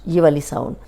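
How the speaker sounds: usual speaking voice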